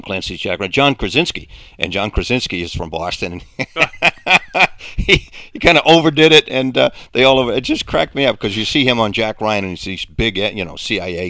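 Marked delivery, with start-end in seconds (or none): 3.6-8.2 words broken up by real laughter; amused laugh while talking about the overdone accent. Real, not acted. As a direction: laugh through it, louder and higher. Laughing while recalling the sketch.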